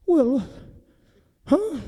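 small, high, soft squeak; taken aback. Retold or imitated. Told by God to stop lying, high and taken aback. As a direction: stammer a high, sheepish little question.

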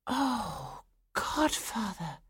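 soft, high, slow gasp of wonder; awed exclamation. A narrator's reading. Clara receiving the nutcracker from her godfather, awed exclamation. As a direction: breathe it out slowly, high and hushed. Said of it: "Clara exclaimed".

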